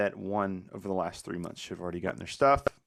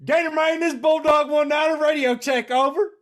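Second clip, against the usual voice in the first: high, loud country radio call; trucker radio call; 8 dB louder than usual. Retold or imitated. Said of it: "this is what we heard".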